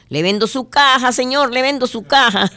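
very high pushy salesman; salesman squeak. Acted. Salesman offers a coffin.

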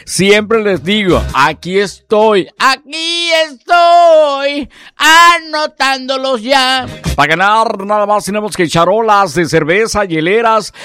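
high, loud, fast radio DJ hype; hyped radio announcer. Acted. Radio host hyping listeners on a live afternoon show. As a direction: sell it like a live on-air promo.

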